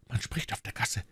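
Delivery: hushed, whispered warning; very soft, secretive alert; urgent whisper. Acted. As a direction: whisper so nobody outside hears. Warning of voices outside, must hush.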